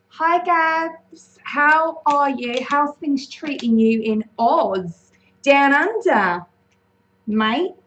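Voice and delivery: high, slow, hammed-up Aussie greeting; bad Australian accent. Streamer greets Gabs in Oz, bad mock Aussie accent. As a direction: put on a drawn-out, badly faked Australian accent. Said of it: "That's a really bad Australian accent".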